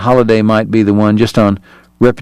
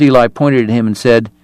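plain reading voice

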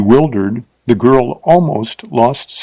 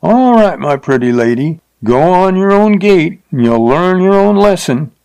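higher, louder call after her, sneering; 7 semitones higher, 3 dB louder than usual. A narrator's reading. Rider calls after the fleeing teacher, sneering. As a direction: call out mockingly as she flees.

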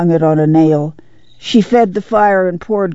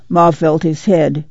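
ordinary delivery, nothing marked

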